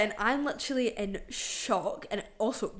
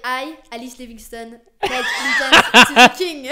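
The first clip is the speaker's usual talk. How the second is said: laughing pledge; 4 semitones higher, 18 dB louder than usual. Retold or imitated. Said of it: "she was like".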